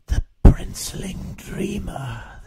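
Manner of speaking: slow hushed whisper full of awe; awed whisper. Acted. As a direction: breathe it like seeing a legend. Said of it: "the Zoog whispered in awe".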